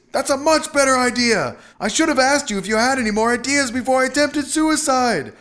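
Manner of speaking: high, loud husband's shout; sustained high-pitched yelling; yelling back. A narrator's reading. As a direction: shout the whole reply over a racket. Husband yelling back over din.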